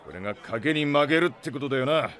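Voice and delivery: ordinary talk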